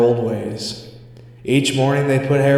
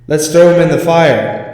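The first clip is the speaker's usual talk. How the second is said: urgent fear; 6 semitones higher, 9 dB louder than usual. Acted. Scared suggestion.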